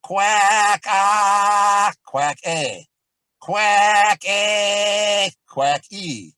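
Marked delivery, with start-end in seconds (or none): 0.0-1.9 obnoxious piercing quack. Shown as a demonstration. Teacher demonstrating the obnoxious quack drill, piercing and loud. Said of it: "it sounds obnoxious". 3.4-5.3 obnoxious piercing quack. Shown as a demonstration. Teacher demonstrating the obnoxious quack drill, piercing and loud. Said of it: "it sounds obnoxious".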